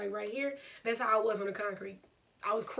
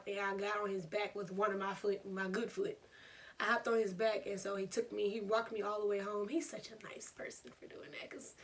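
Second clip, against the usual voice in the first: usual speaking voice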